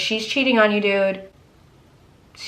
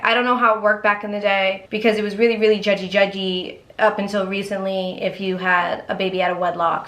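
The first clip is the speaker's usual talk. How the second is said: normal talking voice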